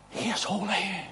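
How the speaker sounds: very soft, breath-held reverence; hushed awe. Acted. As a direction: say it as a solemn near-whisper. Awed warning to the congregation.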